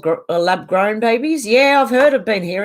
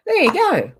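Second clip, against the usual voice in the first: pleased high call; 11 semitones higher than usual. Real, not acted. Another user blocked.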